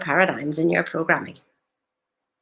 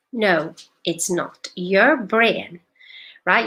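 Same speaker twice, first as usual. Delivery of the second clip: usual speaking voice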